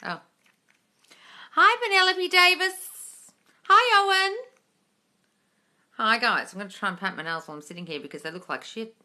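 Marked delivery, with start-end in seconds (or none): 1.5-4.4 gushing greeting. Real, not acted. Greeting viewers joining the livestream.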